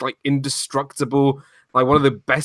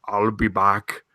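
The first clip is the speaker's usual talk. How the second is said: lower-pitched action-hero catchphrase, amateurishly imitated; Arnold Schwarzenegger impression; 4 semitones lower, slower than usual. Host quoting the film's hero, admittedly terrible imitation. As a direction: do a poor Terminator voice, pitched down. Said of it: "that's when he does his first".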